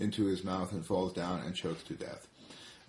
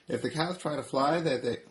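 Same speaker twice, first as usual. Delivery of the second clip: high pig voice easing back down; warning pig, pitch sliding toward normal; lightly voiced piggy; 4 semitones higher, 3 dB louder than usual. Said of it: "says Piggy".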